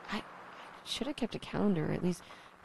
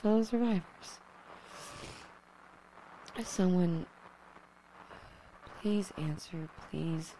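desperate radio call; 4 semitones higher than usual. Acted. Desperate broadcast intro.

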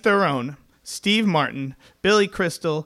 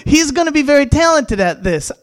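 fake reassurance; 6 semitones higher than usual. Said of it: "You have to be good at lying".

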